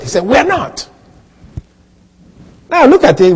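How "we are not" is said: "We are not" lifted high, falling at the end; emphatic assertion. Retold or imitated. Preacher repeating what the author said, emphatic. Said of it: "he was emphatic in the assertion".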